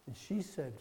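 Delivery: ordinary delivery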